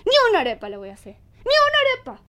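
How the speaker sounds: fast, rising to a high loud shout on ni una arepa; angry refusal. Acted. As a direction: snap the refusal, then blow up.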